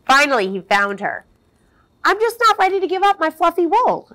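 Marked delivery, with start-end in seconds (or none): 2.0-4.1 high, fast mild sad plea; a touch of sadness clinging to her wool; sadly. Acted. Sheep to Sheepdog, mildly sad about her wool. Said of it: "Sheep said sadly".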